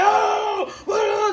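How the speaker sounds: a sudden high, loud "oh, oh"; mock outburst. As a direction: ham up a flustered outburst at the accusation. Mock reaction to being accused.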